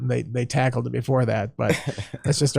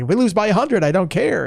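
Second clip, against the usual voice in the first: careless coach; 7 semitones higher than usual. Retold or imitated. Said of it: "It's like".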